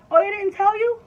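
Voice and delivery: high, loud, breezy doctor's question; mock offhand doctor, high-pitched; nonchalant doctor. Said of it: "so nonchalant".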